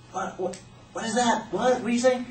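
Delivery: high, hushed puzzled questioning; bewildered question. Acted. Kids hearing a strange sound, bewildered.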